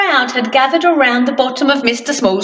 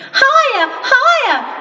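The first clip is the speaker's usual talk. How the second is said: put-on high, gleeful little girl; excited cry; 11 semitones higher than usual. Little girl plays with her ball, gleeful high cry. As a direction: call up to the ball with glee. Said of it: "she cried as she laughed".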